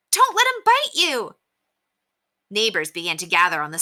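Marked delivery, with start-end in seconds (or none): 0.1-1.4 urgent, very high-pitched shout; shouted warning. A narrator's reading. Woman warns Henry about the fighting dog, shouting high. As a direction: cry out a warning. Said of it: "shouted the woman".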